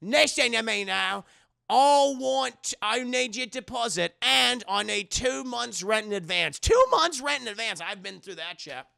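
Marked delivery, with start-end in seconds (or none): 0.0-6.6 higher, louder, scolding rant; stern old landlady. Retold or imitated. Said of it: "She goes". 6.6-7.8 much higher and louder, incredulous; outraged repeat. Real, not acted. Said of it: "streamer repeats in outrage".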